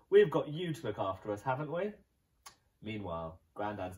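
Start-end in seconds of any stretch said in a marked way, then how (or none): 0.1-1.9 smug family. Acted. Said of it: "said the Gumboils".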